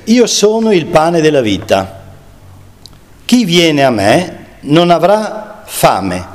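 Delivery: slow, measured Gospel reading; solemn scripture reading. Acted. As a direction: let each phrase land slowly. Reads Gospel words of Jesus.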